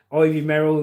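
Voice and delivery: normal talking voice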